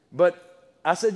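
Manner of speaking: ordinary talk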